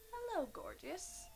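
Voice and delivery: high, soft sinister hello; creepy killer greeting. Retold or imitated. Killer greeting the victim's wife, softly creepy. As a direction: coo it quietly, menacingly.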